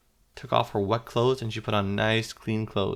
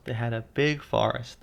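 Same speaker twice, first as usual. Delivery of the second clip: ordinary delivery, nothing marked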